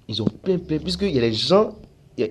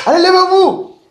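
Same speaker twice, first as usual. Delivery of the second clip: much higher, much louder command; fervent pulpit yell; shouting preacher; 14 dB louder than usual. Retold or imitated. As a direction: rouse the crowd to its feet. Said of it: "Le gars crie".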